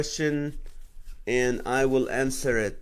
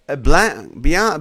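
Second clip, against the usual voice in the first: ordinary delivery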